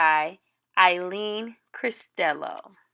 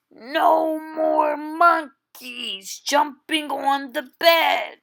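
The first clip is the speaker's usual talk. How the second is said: a touch firmer, like a doctor losing patience; stern doctor; 4 semitones higher than usual. Acted. Doctor's third warning.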